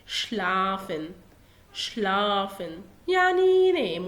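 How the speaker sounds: شلافن said slowly as shlafen; slow demo. Shown as a demonstration. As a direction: stretch شلافن to show the shl sound. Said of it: "وده فعل ينام". (نطق شلافن ببطء)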